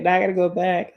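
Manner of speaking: ordinary delivery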